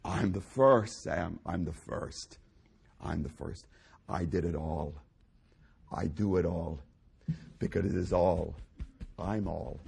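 very low, soft solemn declarations; quiet, deep pulpit murmur; hushed preaching. Acted. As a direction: sink to a weighty hush as God speaking. Preacher speaks as God, hushed.

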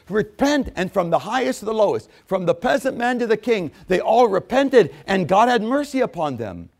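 high, building pulpit cadence; rising preaching. Acted. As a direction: lift the congregation. Preaching climax.

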